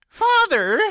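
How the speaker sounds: high, loud shrill snap; snapping daughter. A narrator's reading. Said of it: "Anhelda snapped".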